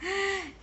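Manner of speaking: short high chuckle; real amused giggle; light, high laugh. Real, not acted. Reader amused at story's end.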